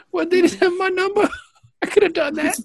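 high, self-pitying fake sob; mock whimpering. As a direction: whimper like a passed-over actor. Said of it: "who's just whimpering?".

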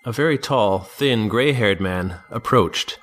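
plain reading voice

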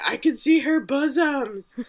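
mock-appalled older lady, drawn out slowly; scandalized mom. Jeff's mom upset at the wedding pen, slow scandalized mock voice. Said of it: "Jeff's mom was very upset".

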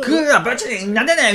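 high mock fishing yell breaking into a laugh; playful excited cry. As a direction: ham up the big catch. Acting out a fishing catch. (笑いながら高い声ではしゃぐ)

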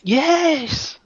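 a sudden high eager yes; client's high yes. Retold or imitated. Hypnotized client eagerly answering the therapist, high yes.

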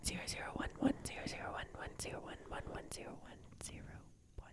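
whispered robot voice reading numbers; robotic whisper. Acted. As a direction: whisper the digits like a machine. Imitating automated numbers voice.

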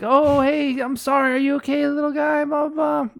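put-on high baby-talk to a dog; mock soothing. Imitating himself comforting a bitten dog, high mock soothing. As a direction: coo in a high, singsong mock apology.

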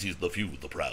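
low, soft ad-narrator voice; a hint of a Marines ad voiceover; Keith David impression. Shown as a demonstration. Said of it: "does the voice of the Marines".

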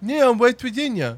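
high, slurred janitor imitation; insisting janitor voice. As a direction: protest in the janitor's odd high voice. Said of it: "you be the janitor".

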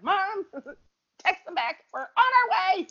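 high-pitched, laughing retold panic; mock-urgent plea. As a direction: call to mom in exaggerated high-voiced urgency. Retold urgent call to mom while driving.

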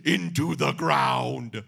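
slow bellowed wrestler shout; wrestling promo. Retold or imitated. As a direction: roar it like an unhinged wrestler.

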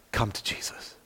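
soft unvoiced plea; whispered invitation. Real, not acted. Preacher inviting listeners to Jesus, in a whisper. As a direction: breathe it out as a gentle whisper. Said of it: "altar-call whisper".

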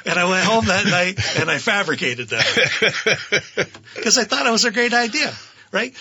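laughing through the words. Real, not acted. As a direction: giggle while you confess. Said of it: "laughing at his own lie".

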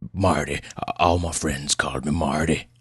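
put-on gruff, folksy old patient; gruff old man. Old patient offers the doctor his nickname, gruff. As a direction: correct him in a rough country voice. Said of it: "Pleasanton's voice was as gruff as his appearance".

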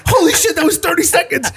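reacting to Chuck leaving after one beer, laughing shout; shocked laughing shout. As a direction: blurt it out in disbelief. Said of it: "I'm like".

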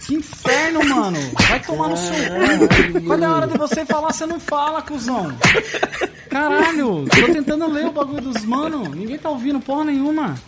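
exasperated yelling at friends; angry rant. Real, not acted. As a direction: snap at them, fed up. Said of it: "O cara ficou bravo, gente".

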